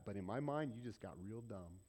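usual speaking voice

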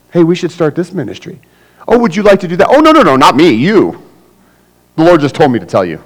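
high and loud, bossing Dennis; pushy know-it-all. Acted. Churchgoer pressing Dennis to go to Sardis, high and bossy. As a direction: lean in and tell him what God said.

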